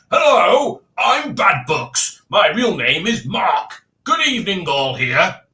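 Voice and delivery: loud, high, drawn-out comic introduction; hammed-up character voice, swooping high; mock viewer voice. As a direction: announce yourself as a slow, booming oddball. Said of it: "Mark doesn't talk like that".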